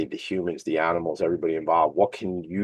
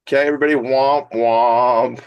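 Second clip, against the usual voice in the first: mock womp womp; 4 semitones higher, 5 dB louder than usual. Sad trombone after dad joke.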